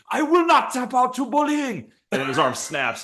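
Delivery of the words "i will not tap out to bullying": much higher, drawn out and pushed harder; higher, slower strained vow. Pretend. Fighter in an arm bar, vowing higher and slower. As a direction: squeeze it out even higher as the arm gives. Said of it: "he's like".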